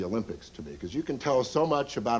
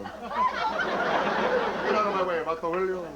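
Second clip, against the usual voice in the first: slow, higher mock Mexican accent; 6 semitones higher, much slower than usual. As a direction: drawl it out like a cocky Mexican driver. Mexican team driving out.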